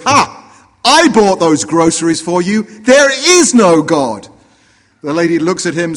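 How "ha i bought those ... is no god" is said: triumphant gotcha shout. Acted. Neighbour springing out at the old lady, triumphant shout. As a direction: crow it out, stretching the last sentence. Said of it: "jumps out from behind a bush and says".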